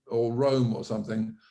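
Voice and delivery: normal talking voice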